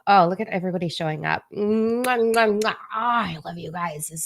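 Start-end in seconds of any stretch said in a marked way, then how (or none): 1.5-2.7 smacking air kisses; between kisses. Real, not acted. Blowing kisses to the chat.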